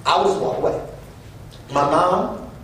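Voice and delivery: ordinary delivery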